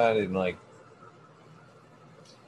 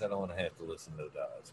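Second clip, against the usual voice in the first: same words, much softer and faster; muttered under breath; 7 semitones lower, 15 dB quieter, much faster than usual. Retold or imitated. Telling the visitor about the dogs, muttered fast and soft.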